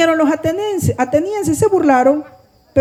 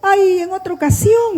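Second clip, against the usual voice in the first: imitated excuse, pitched up; people putting it off; 7 semitones higher than usual. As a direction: mimic a polite, high-voiced brush-off. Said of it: "Ustedes han oído la gente que dice".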